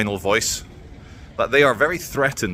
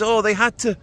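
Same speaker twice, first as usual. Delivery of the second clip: lightly mocking politician, pitched up; pitched-up parody of a lefty minister; mock Yvette Cooper; 8 semitones higher than usual. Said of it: "talking about the poor rioters".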